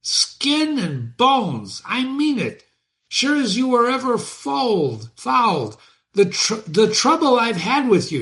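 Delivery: high-pitched furious carter's shout; mad shouting driver. Acted. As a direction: bellow at the stuck horse like a man gone mad. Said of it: "lashed and shouted as if mad".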